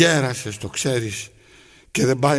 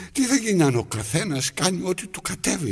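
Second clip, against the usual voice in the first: ordinary talk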